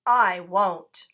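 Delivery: loud short retort; shouted. A narrator's reading. Dog shouting back at the rat, loud. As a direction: fling it back. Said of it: "shouted Dog".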